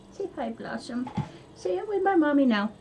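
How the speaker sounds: high cooing voice for Blossom; pet talk to the dog. Real, not acted. Owner asking Blossom to greet viewers, cooing.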